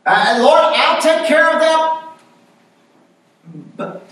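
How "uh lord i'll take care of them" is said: much higher, mock sweet promise; imitating Miss Melissa. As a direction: play her pious excuse, pitched up. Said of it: "Miss Melissa, she said".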